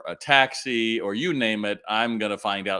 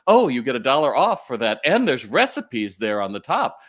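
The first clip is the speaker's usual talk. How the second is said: playful announcement; 4 semitones higher, 6 dB louder than usual. Retold or imitated. Acting out cashier's playful coupon announcement.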